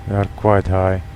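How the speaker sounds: normal talking voice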